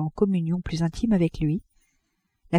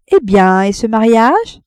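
louder, teasing ironic question; 3 semitones higher, 6 dB louder, slower than usual. A narrator's reading. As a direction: tease him about the marriage. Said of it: "Elle le regarda ironiquement". (ironique, plus fort, taquine)

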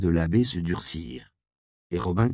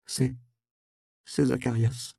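voix étouffée par les pleurs; 5 semitones higher than usual. Acted. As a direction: get the name out through sobs. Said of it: "d'une voix étouffée par les pleurs". (voix étouffée de larmes, hésitante)

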